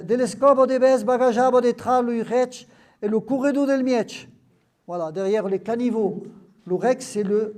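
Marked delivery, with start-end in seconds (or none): 0.0-4.3 reading the Occitan words aloud, a little louder; lecture en occitan. Acted. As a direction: read the patois list with care. Lit un texte occitan. (lecture appliquée en occitan)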